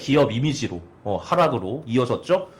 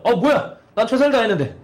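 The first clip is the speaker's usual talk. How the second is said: a touch of huffy indignation, higher and louder; mock indignant owner; 7 semitones higher, 4 dB louder than usual. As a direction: play the miffed owner, half laughing. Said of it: "사장이 화가 났겠죠". (살짝 웃으며 억울한 척)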